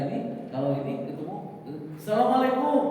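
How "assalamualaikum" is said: 1 greeting. Acted.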